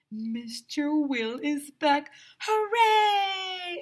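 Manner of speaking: high, loud, slow cheer; excited cheering. Acted. Kids cheering the ice cream man's return, loud and high. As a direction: cheer like the whole class. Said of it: "everyone shouted".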